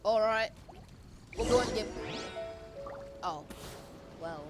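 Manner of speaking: ordinary delivery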